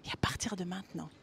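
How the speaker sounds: put-on soft, hushed tenderness to the inner child; tender whispered I love you. Shown as a demonstration. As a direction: whisper it like cradling a baby. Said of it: "Et tu lui dis juste".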